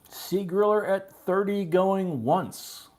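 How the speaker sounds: slow, high, ringing auction call; auctioneer's call. Real, not acted. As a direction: call the countdown loud and drawn out. Auction countdown, first call.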